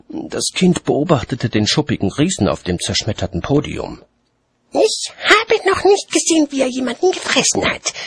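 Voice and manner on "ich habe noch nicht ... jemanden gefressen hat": put-on very high-pitched childish complaint; complaining child. A narrator's reading. Child disappointed by dragon, complaining in high voice. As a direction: complain like a let-down little kid. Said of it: "beklagte es sich".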